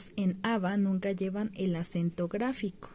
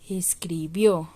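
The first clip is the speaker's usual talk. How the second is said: Escribió said slowly with a loud final -BIÓ; es-cri-BIÓ, last syllable stressed; 4 semitones lower, 5 dB louder, much slower than usual. Shown as a demonstration. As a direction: punch the end of Escribió. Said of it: "en donde lleva el acento".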